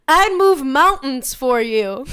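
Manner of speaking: mild mock yell, slow, with a laugh; half-hearted slow yell that dissolves into laughter; teeniest yell. Imitating the date's feeble yell for the host, a mild mock yell. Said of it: "the teeniest yell to be like".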